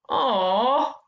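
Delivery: ordinary talk, nothing marked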